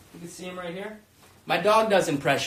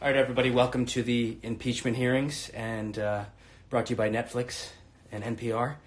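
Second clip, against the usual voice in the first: lower, formal chairman voice; a lightly voiced solemn chairman; hearing chairman; 7 semitones lower, 5 dB quieter than usual. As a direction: open the hearing like a stiff official. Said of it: "I wonder what that would have been like".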